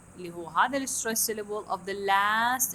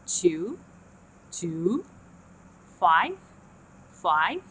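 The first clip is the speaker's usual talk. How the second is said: "Two" and "five" each start low, end high; rising glide up; 4 dB louder, much slower than usual. Shown as a demonstration. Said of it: "The start is low and the end is high". ("two" و"five" بنبرة تبدأ واطية وتنتهي عالية)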